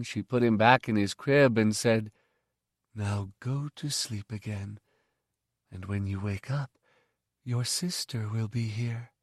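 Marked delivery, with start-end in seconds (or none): 2.9-9.1 much softer lullaby-like hush; soothing. Acted. The mother tucks Miv into his crib, softly soothing. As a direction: settle him gently toward sleep. Mother settling child to sleep.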